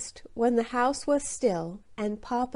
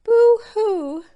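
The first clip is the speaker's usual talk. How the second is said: loud crying; 12 semitones higher, 10 dB louder than usual. A narrator's reading. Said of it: "He only cried".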